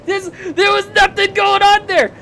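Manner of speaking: high, loud exasperated cry; exasperated outburst. Real, not acted. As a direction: throw your hands up at the nonsense. Rant about forced romance.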